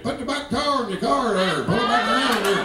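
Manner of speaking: R-heavy North Georgia twang; thick rhotic North Georgia accent. Acted. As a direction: hit every R like a North Georgia hillbilly. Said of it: "everything got R in it".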